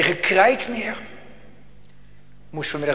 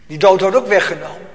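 louder emphatic repeat; 4 semitones higher, 9 dB louder than usual. Acted. Repeated louder, stress on ook.

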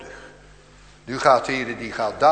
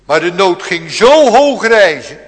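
loud declaiming, slightly higher; fervent preaching; 6 semitones higher, 16 dB louder than usual. Acted. Preacher stressing growing need, loud fervent preaching. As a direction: build it up from the pulpit.

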